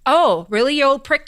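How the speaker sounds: lightly hammed-up outrage; indignant Scrooge. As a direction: scoff back at the ghost. Scrooge snaps back at ghost.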